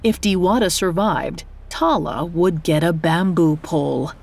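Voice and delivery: plain reading voice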